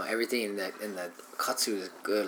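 ordinary talk, nothing marked

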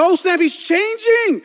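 high, loud, slow amazed exclaim; watching the Beast transform, thrilled; excited. Retold or imitated. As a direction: gasp it in awe. Excited viewer reaction.

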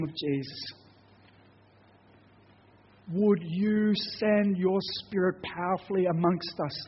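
closing prayer. Acted. Pastor praying over his congregation, earnest and swelling. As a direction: pray over the church, pleading for boldness.

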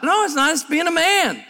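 ordinary talk

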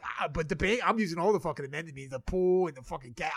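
ordinary talk

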